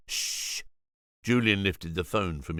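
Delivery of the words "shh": sharp unvoiced shush. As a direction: hush him sharply. Julian shushes.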